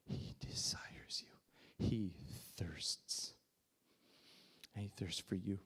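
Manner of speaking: soft and reverent, letting each word sink in; hushed, intimate. Real, not acted. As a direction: whisper it slowly, deeply moved. Moved preacher tells of God's longing.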